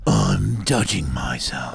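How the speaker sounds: slow, drawn-out creepy voice; heavy-breathing creep. Acted. Co-host acts out the caller stroking himself, slow creepy voice. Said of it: "him breathing heavily and stroking himself".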